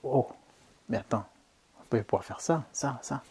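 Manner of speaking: retold inner voice, soft and marvelling; hushed retold wonder. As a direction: murmur a dawning realization to yourself. Retells his own amazed inner thoughts.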